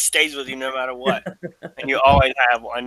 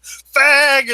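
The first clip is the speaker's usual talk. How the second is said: mock insult shout; 10 semitones higher, 5 dB louder than usual. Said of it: "and he's like".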